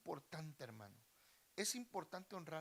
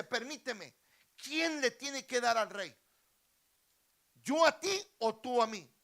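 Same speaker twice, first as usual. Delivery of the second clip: high, forceful royal questioning; loud challenge; 7 semitones higher, 15 dB louder than usual. Acted. King asking who should give to whom, loudly challenging.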